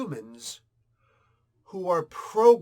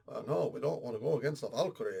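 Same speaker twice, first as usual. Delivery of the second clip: lower, softer, cowering Viking men; 5 semitones lower, 3 dB quieter, faster than usual. Retold or imitated. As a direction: back down like scared Vikings. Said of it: "my Viking impression right there".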